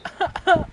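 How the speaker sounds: short high loud burst of laughter; real laugh. Real, not acted. Listener laughs at the story.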